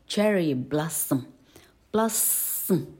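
blossom said slowly, "bloss-m"; slow syllabic m. Shown as a demonstration. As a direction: end blossom on a bare m. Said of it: "slow demo".

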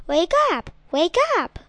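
loud eager wake-up call. Acted. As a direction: shout to wake your dad. Cub waking father in cave.

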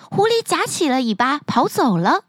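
ordinary delivery, nothing marked

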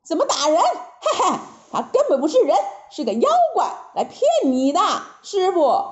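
lightly voiced cocky Monkey King with a scoffing chuckle; Monkey King setting his master straight; Monkey King, scornful.

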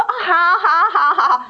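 带笑带哭腔地连声答应; 像松了一口气，笑着哽咽说好; 又哭又笑. Retold or imitated. God speaks again after long silence. (听到上帝说话后边哭边笑地应答)

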